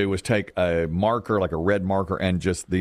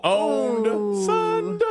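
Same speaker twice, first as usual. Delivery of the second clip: high, hyped-up hooting; playful hype-man cheers; mock triumphant heckling; 11 semitones higher, much slower than usual. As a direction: crow over a friend's knockout comeback, drawn out and high. Crowing over friend's winning comeback.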